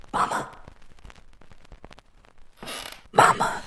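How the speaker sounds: breathless unvoiced whisper, scared; a frightened child whispers into the dark; terrified whisper. Boy in bed calling his mother in fear, whispered. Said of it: "whispering, terrified".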